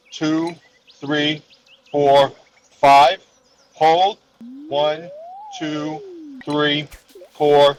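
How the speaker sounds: slow counted breathing guide; breathing coach. Shown as a demonstration. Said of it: "guides breathing count".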